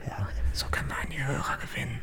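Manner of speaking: breathy whisper right into the mic; close-mic whisper. Pretend. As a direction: lean in and whisper to hook listeners. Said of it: "ganz nah ans Mikro gehen". (geflüstert, ganz nah am Mikro)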